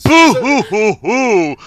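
hammed-up boo-hoo sobbing, laughing; mock wail of boo-hoo; fake crying. Booing the movie, mock sobbing.

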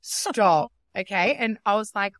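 normal talking voice

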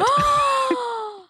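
sharp surprised gasp; shocked gasp. Real, not acted.

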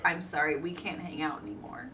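a prim, polite brush-off dropped low and quiet; imitating the posh cousin who outgrew the family. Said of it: "got a little too posh for everybody else".

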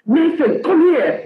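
put-on high, loud Nigerian-accented call; angry Nigerian accent. Mum calls her son over in a Nigerian accent, angry. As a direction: summon Nathan like a cross Nigerian mum. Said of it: "mommy's angry. She's in a Nigerian accent".

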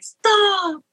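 high, drawn-out mock protest; play-protest like a mom who secretly loves it; mom's put-on "quit it", pitched high; feigned protest. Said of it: "why are you pretending".

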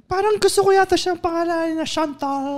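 high mock wife voice. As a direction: play the sweet wife. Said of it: "Oh, kunyari".

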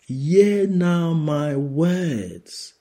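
high, slow, loud proclamation; preaching. Acted. Preacher voicing the Lord to the congregation, slow and high. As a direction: lift the words up slowly.

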